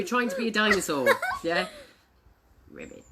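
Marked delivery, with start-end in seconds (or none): 2.7-3.0 very soft little croak; frog ribbit. Acted. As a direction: slip in a tiny quiet frog noise.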